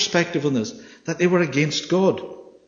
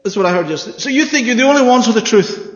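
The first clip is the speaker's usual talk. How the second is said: imitating an accuser, raised and indignant; accusing challenge; 9 semitones higher, 6 dB louder than usual. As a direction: throw the charge back at them scornfully.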